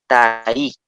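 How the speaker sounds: "tai" drawn out slowly, stress landing on the I; stress on final vowel. Shown as a demonstration. Teacher showing the word for letter, slow "tai" with stressed I. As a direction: stretch "tai" and push the final I. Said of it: "la última vocal con mayor fuerza de voz".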